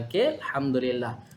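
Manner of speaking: normal talking voice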